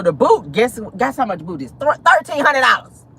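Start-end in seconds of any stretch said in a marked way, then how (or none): 1.7-3.1 higher, run together in disbelief; shocked price reveal. Real, not acted. Host revealing the boot price, shocked. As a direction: blurt the price out, aghast.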